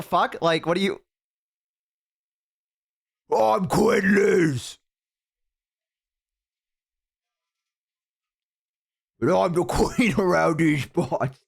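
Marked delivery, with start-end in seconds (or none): 3.4-11.4 slow hammed-up orc boast; mock orc voice. Reactor mocking the orc names, slow hammed-up orc voice. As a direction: draw it out like a swaggering orc warlord. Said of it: "I can do a good orc voice".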